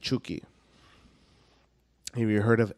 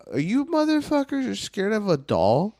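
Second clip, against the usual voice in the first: incredulous; 10 semitones higher, 5 dB louder than usual. Real, not acted. Mocking disbelief.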